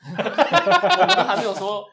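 talking through a big laugh, high and loud; laughing words. Real, not acted. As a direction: protest while cracking up. Laughing while protesting the break.

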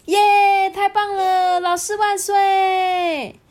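high, drawn-out class cheer; excited cheering. Acted. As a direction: cheer it out high, stretching the last words. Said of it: "大家听了都非常的兴奋呢". (兴奋地高声欢呼)

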